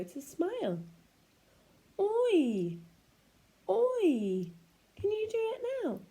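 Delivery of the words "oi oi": Oi! said loud, lips round then spread; oi sound. Shown as a demonstration. Teacher showing children how to make the oi sound. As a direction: say Oi! from a circle mouth into a smile. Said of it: "start off with your mouth in a circle".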